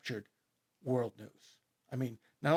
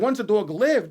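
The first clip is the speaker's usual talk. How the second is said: normal talking voice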